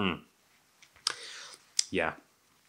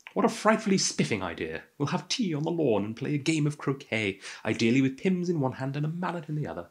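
higher-pitched, hammed-up English garden-party voice; put-on English accent; 6 semitones higher than usual. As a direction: play up the English accent with mock delight. Playful posh English garden-party act.

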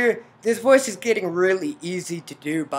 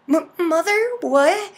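higher, stammered disbelief; shocked heroine; 11 semitones higher, 6 dB louder than usual. Acted. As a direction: act out stunned recognition. Heroine recognises her mother.

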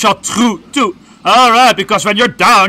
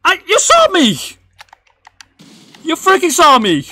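high-pitched outraged protest; indignant yelling; 11 semitones higher than usual. Real, not acted. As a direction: yell at the game like it cheated. Protesting a missed input.

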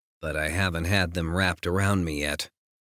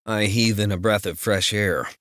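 ordinary delivery, nothing marked

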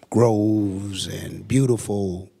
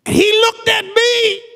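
high, loud climactic preaching; shouted, rising sermon climax; full-force preaching; 7 dB louder than usual. Acted. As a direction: build the sermon to a roaring peak.

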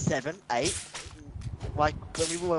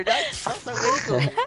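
high-pitched; slower than usual. Real, not acted. Protesting being filmed.

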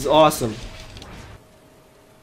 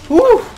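short, high, loud woo; celebratory whoop; 7 dB louder than usual. Real, not acted. Gamer after getting them all, celebratory whoop. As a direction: punch out a quick high whoop.

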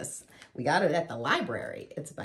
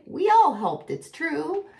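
high, raised voices; happy cry; 10 semitones higher, 5 dB louder than usual. A narrator's reading. Animals answer Mouse's praise, high excited cry. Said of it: "they cried".

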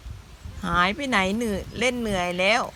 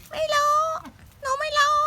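put-on cute baby voice. Voicing the baby animal.

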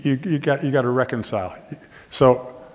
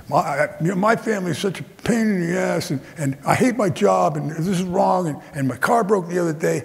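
higher whiny complaining drone; whiny complainer; 7 semitones higher than usual. Retold or imitated. Imitating whining loud man.

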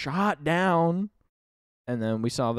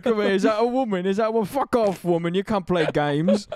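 rowdy gamer voice; 6 semitones higher, 4 dB louder than usual. Pretend. Acting out angry gamers.

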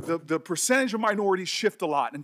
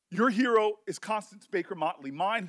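normal talking voice

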